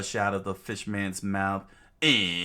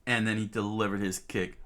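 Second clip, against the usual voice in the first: ordinary delivery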